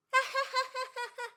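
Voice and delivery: high, loud, unnatural laugh; forced mocking laugh. Boy laughing back at Kura's name, forced. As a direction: fake a spiteful laugh at his name. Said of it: "僕は仕返しをするために笑ってやった".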